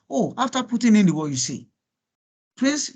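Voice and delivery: ordinary delivery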